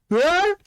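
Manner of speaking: short, high, loud scream; sudden scream. Acted. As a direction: cry out as if falling into a pit. Scream heard from the pit.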